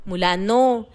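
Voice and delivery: ordinary delivery, nothing marked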